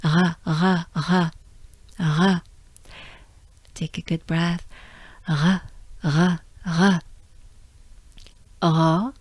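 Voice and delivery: R growled in the throat; French r drill. Shown as a demonstration. Teacher drilling the French R. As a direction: repeat the uvular R. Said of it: "It starts with a R".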